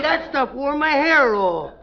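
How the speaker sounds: ordinary talk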